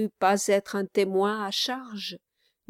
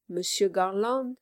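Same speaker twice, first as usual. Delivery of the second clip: ordinary delivery, nothing marked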